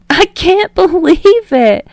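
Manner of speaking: high, slow, wheezing through laughter; can barely get the words out for laughing. Acted. Kaminari shows Bakugo's baby photo, wheezing with laughter. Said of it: "he wheezes".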